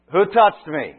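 loud, high demo call over a crowd. Shown as a demonstration. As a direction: shout it out to the whole crowd. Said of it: "he's got to raise his voice".

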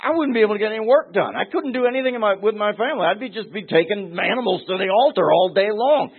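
high, fast whiny mock of a flustered worshipper; hammed-up exasperation, rattled off quickly; mock complainer. Preacher mocking people who misread the law, high and whiny. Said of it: "I've heard people talk like this and say".

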